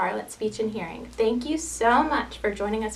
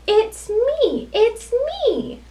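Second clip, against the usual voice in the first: high, sing-song reveal; storybook pig; 13 semitones higher, 6 dB louder, much slower than usual. Acted. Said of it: "says the pig".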